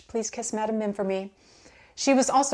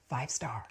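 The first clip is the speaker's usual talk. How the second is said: relieved gasp; 7 semitones lower, 10 dB quieter than usual. Retold or imitated. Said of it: "And I get there".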